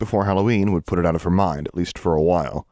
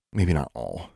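low, soft mumble; mumbled admission; 4 semitones lower, 5 dB quieter, slower than usual. A narrator's reading. Guilty Alexandra concedes to Anna, mumbling softly. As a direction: mumble it almost under the breath. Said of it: "she mumbled quickly and almost inaudibly".

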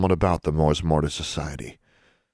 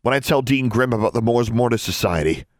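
higher, defiant threat; shooting back; 5 semitones higher than usual. A narrator's reading. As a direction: threaten to report her. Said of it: "Alexandra shot back".

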